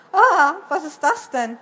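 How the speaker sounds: loud startled yelp, then the question. Acted. Jonas jumps aside from his glowing trousers, startled. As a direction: cry out as you jump back in fright. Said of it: "Jonas sprang erschrocken zur Seite".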